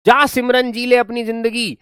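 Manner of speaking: imitating Amrish Puri. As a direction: roar it like the stern father letting her go. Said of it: "Amrish Puri होते हुए कहते".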